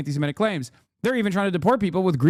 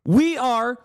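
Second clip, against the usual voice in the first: high, slow, emphatic proclamation; mock-grand declamation; 8 semitones higher, slower than usual. Streamer declaring this man a born American, mock-grand declamation. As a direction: proclaim it like an impassioned orator, drawing out phrases. Sustained mock-solemn rant.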